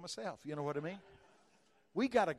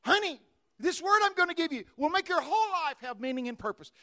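much higher and louder, bursting with excitement; excited calling; 12 semitones higher, 13 dB louder than usual. Retold or imitated. Preacher thrilled, calling the prophecy to the old lady. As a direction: announce it to her with thrilled delight.

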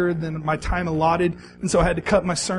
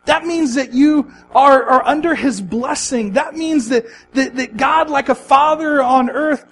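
preaching; 4 semitones higher than usual. Acted. Pastor stirs the congregation, fervent preaching. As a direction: build the sermon up with passion.

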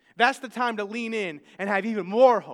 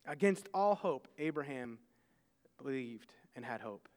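fading to soft; 10 semitones lower, 10 dB quieter than usual. Acted. Repeating verse, fading soft.

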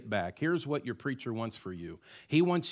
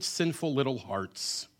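ordinary talk, nothing marked

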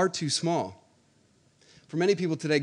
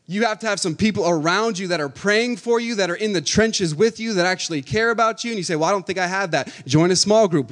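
forceful preaching; 5 semitones higher, 5 dB louder than usual. Acted. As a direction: proclaim the verse with weight.

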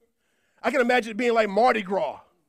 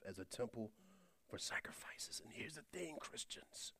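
hushed aside; 10 semitones lower, 25 dB quieter than usual. Real, not acted. Preacher confiding in the church, in a hushed whisper. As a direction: drop to a near whisper for the church.

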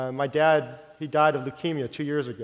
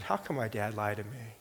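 retold sobbing plea; 8 dB quieter than usual. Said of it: "I just started sobbing like a baby".

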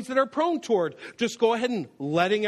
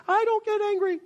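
much higher, squeaky and defensive; 13 semitones higher than usual. Shown as a demonstration. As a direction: squeak it out like you're denying it. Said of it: "always a high, squeaky, defensive voice".

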